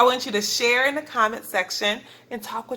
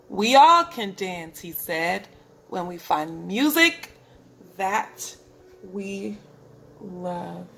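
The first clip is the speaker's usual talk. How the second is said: a gentle wise ending; 4 semitones lower than usual. Acted. Gerald's gentle closing words.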